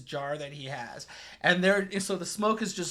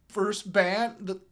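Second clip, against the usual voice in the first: ordinary talk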